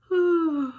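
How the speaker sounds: loud, falling weary sigh; sleepy sigh after the show; tired sigh. Acted. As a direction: let out a worn-out sigh. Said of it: "dead tired".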